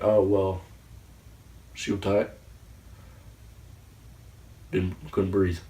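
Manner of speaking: lightly voiced character impression; halting, understated character imitation with long pauses; Eugene. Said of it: "That was my best Eugene impression".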